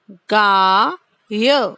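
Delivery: गाय stretched very slowly; slow drill. Shown as a demonstration. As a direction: drag out गाय for kids. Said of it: "उच्चारण करना है इसका बार-बार".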